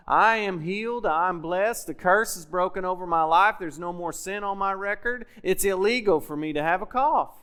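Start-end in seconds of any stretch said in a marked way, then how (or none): none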